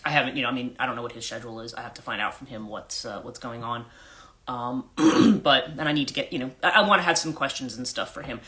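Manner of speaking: usual speaking voice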